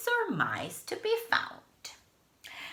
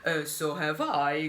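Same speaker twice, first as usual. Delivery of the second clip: louder grumbling reply; grumbled; 4 dB louder than usual. Acted. Mister Snore grumbling to the bellhop, grumbled. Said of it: "grumbled Mister Snore".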